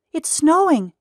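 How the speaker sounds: put-on high, loud boyish shout; excited yell. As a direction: burst out with joy. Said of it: "he yelled with excitement".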